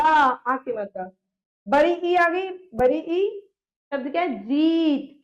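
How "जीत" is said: जीत with a long held "ee"; stretched long vowel. Shown as a demonstration. Teacher showing how the vowel sign stretches, drawn-out vowel. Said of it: "खिंचती है मात्रा".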